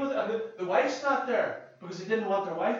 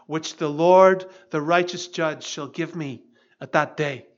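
building scripture read, peaking loud on Lord; 2 semitones lower, 5 dB louder than usual. Acted. Pastor reading Paul's farewell to the congregation, rising to loud proclamation. Reads Paul's words aloud.

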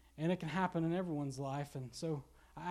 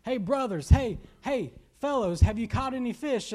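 loud, high, friendly hail; calling out; 5 semitones higher, 8 dB louder than usual. Acted. Jesus hails his disciples in the boat, calling out. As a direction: holler to mates across the water. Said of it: "And he called out".

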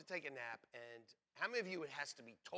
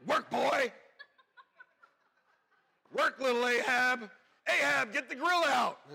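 much higher and louder, mock bossy wife giving orders; 8 semitones higher, 12 dB louder than usual. Pastor imitating his wife ordering him to work, hammed-up bossy voice. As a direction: bark out chores like a teasing wife, laughing.

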